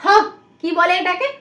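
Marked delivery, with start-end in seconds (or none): 0.0-0.1 "হ" as the short, isolated sound of H; letter sound demo. Shown as a demonstration. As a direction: say "হ" as one short phonics sound. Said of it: "উচ্চারণ করো". ("হ" অক্ষরের ছোট আলাদা ধ্বনি)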